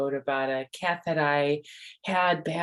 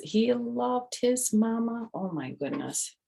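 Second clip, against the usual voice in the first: ordinary delivery, nothing marked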